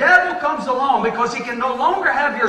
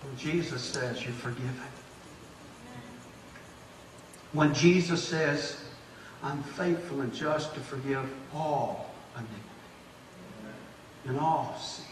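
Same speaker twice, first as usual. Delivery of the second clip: much softer and lower, gentle; tender hush; 9 semitones lower, 14 dB quieter than usual. Acted. The preacher voicing Jesus forgiving the sinner, very soft and tender. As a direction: drop to a near hush to comfort.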